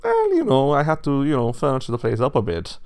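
high, loud, well-to-do pig voice; airy rich pig. A narrator's reading. Rich pig excuses alterations to the wolf, airy. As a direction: brush it off as a wealthy pig, pitched high.

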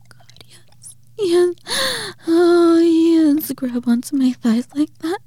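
high, loud, breathless cries; moaning. Acted. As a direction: cry out in pleasure. Arousal, high strained cries.

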